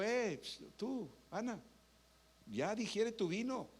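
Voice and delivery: put-on high, sneering old priest's rebuke; mock scolding priest. Priest Elí scolding Ana in the temple, mocking and high. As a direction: brush her off as a drunk.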